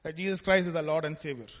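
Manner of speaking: ordinary talk, nothing marked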